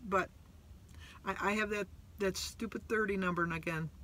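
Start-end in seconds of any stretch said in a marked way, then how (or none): none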